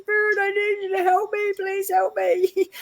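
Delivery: high-pitched begging for help, re-enacted; desperate plea. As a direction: plead upward in a stretched-out cry.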